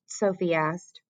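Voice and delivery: plain reading voice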